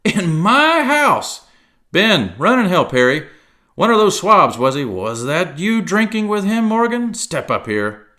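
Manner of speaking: put-on high, loud, blustering old sea cook; Long John Silver voice. A narrator's reading. Silver ordering his men about the tavern, blustering. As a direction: bark orders like a one-legged pirate cook.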